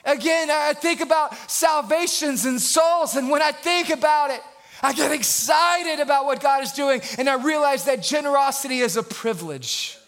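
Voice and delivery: high, exclaimed pulpit delivery; fired-up preacher in full voice; excited preaching. Real, not acted.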